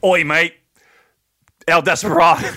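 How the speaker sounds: loud put-on British accent. Host showing off his British to co-host, mock British accent. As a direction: belt out a mock British "oi, mate". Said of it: "I was doing my British".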